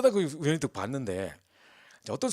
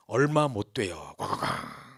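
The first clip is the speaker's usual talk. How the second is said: slow dramatic warning; 3 semitones lower, 4 dB louder, slower than usual. Retold or imitated. Dramatic retelling of the storm.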